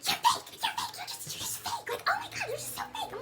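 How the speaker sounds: high, strained sobbing accusation; mocking crying friend. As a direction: shriek it out through tears. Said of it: "She's like".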